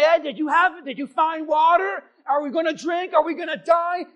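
high, loud demanding questions; angry, bitter crowd. Acted. Bitter people confronting Moses about water, angry shouted demands. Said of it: "they're angry and they're bitter".

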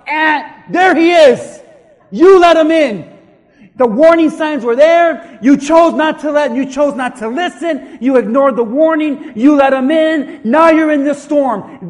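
loud, driving rebuke as God; accusing shout. Acted. Preacher as God blaming those who ignored warnings, accusing shout. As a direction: hurl each charge at full volume. Acts God confronting the person.

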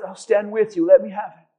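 soft, low, gentle promise; calm the angry one; lightly voiced tender Jesus; reassuring Jesus. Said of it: "And Jesus says".

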